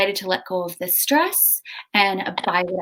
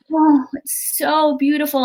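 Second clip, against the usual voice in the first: awed delight; 6 semitones higher than usual. Retold or imitated. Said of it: "And so I just said out loud".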